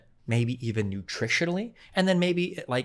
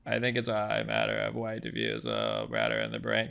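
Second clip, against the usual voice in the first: mocking co-host's voice; 5 dB quieter than usual. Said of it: "what's the voice you do".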